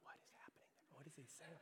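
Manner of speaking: barely audible, breath-only whisper; baffled whisper. Retold or imitated. Leaning in to follow a stranger's story, baffled whisper. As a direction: whisper it to yourself, totally lost.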